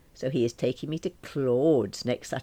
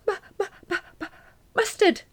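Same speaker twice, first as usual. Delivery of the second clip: much higher, stammering squeak; 4 dB louder, faster than usual. Acted. Alison chokes on mustard, squeaking high and stammering. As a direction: stutter it out in a high squeak, nose burning. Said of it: "I squeaked".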